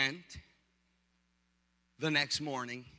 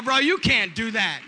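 loud, slow, higher reprimand; retold scolding; 4 semitones higher, 8 dB louder than usual. Pastor to husband about fighting, loud drawn-out scolding. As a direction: tell him off loudly, drawing it out.

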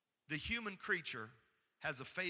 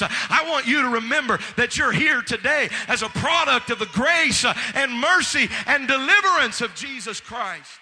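loud, high preaching building to a full shout; preacher screaming; 6 semitones higher, 16 dB louder than usual. Real, not acted. Preacher reminding people who delivered them, building to screamed preaching. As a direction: scream the reminder at the congregation, ever more forcefully. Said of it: "this preacher's gonna scream a little bit".

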